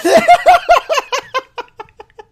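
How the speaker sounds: loud high shrieking laughter. Real, not acted. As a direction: howl with laughter. Laughing hard.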